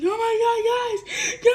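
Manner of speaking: high, loud mock wail to the others; crying out. Crying to people who could not hear, high wail. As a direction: imitate a drawn-out, sobbing cry for help. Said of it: "I cried hard and I was like".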